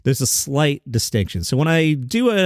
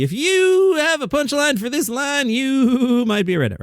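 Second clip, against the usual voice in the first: much higher, far-off joke-setup voice; half-ass Jeff Foxworthy; 12 semitones higher than usual. Shown as a demonstration. Host demoing his half-ass Foxworthy impression. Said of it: "I do a half-assed Jeff Foxworthy".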